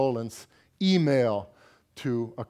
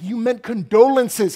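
loud, higher exasperated wife; exasperated correction; 10 semitones higher, 11 dB louder than usual. Retold or imitated. Wife scolding husband about his condiments email, loud and exasperated. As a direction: correct him loudly, exasperated.

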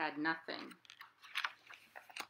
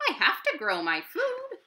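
high, louder rabbit voice; Rabbit's voice; 9 semitones higher, 7 dB louder than usual. Acted. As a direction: insist in a small high voice. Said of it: "replied Rabbit".

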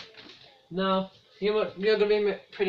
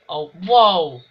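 surprised shout; 12 dB louder than usual. Real, not acted. Sudden chance.

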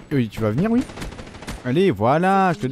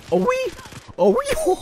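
very high squeal of joy; gamer's thrilled squeal; ecstatic cheer; slower than usual. Real, not acted. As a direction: shriek with delight at the kill. Scoring a kill.

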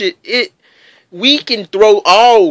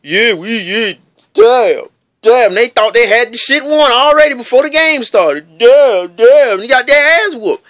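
louder, higher cocky trash talk imitation; mock cocky fans; 4 semitones higher, 4 dB louder than usual. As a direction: ham up the overconfident fans. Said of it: "when you talk shit and you do all this".

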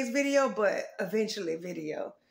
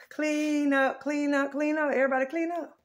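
put-on parent calling kids; 5 semitones higher, 6 dB louder than usual. Mother calling her kids.

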